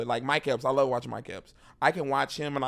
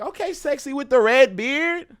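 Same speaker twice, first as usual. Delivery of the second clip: high, louder flirty tease; flirty crowd work; 12 semitones higher, 6 dB louder than usual. Pretend. Comic flirting with a red-bearded fan, high and loud. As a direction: playfully hit on the red-bearded guy. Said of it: "I'll be like".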